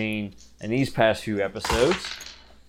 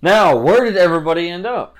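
teasing announcer; 9 semitones higher, 12 dB louder than usual. Acted. GM teases the next volume.

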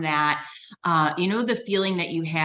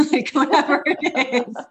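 laughing; 10 semitones higher, 5 dB louder than usual. Real, not acted. Joking about cutting off brother.

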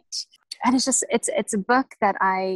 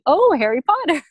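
excited; 8 semitones higher, 6 dB louder than usual. Retold or imitated.

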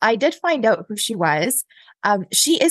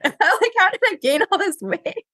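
high-pitched mock disbelief at herself; laughing dismay; 10 semitones higher than usual. Woman seeing her photo from behind, laughing dismay. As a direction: replay your shock with a laugh, pitched well up.

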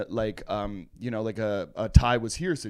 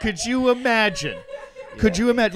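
high, loud, through laughter; laughing disbelief; 8 semitones higher, 5 dB louder than usual. Real, not acted. Co-host reacting to the archaeologist's delighted statement, laughing disbelief. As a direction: crack up while marvelling.

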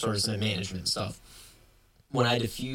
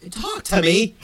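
frustrated raised shout, pitched way up; exasperated yell; 10 semitones higher, 7 dB louder, slower than usual. Retold or imitated. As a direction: shout it as if begging someone to answer. Retelling pleading with silent customer.

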